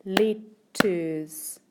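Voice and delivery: "letters" split in two with a gap; deliberate syllable-by-syllable "letters"; broken into syllables. Shown as a demonstration. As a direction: say "letters" as two separate clapped beats, let, ters. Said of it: "Can you clap that word".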